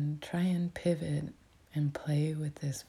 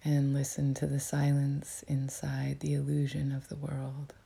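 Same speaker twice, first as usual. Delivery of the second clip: whispering. Real, not acted. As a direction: whisper it gently, like guiding a meditation. Whispers throughout to guide meditation.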